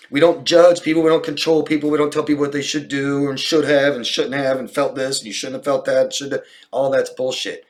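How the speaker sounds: loud, high, forceful tirade; emphatic rant. Real, not acted. Therapist telling a viewer nobody controls others, loud emphatic rant. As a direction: lay down the rule forcefully.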